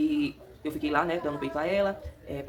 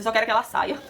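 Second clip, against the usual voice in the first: louder, laughing as she quotes herself; laughing retort; 6 semitones higher, 7 dB louder than usual. Retold or imitated. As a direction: laugh while blurting it out. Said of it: "Eu disse".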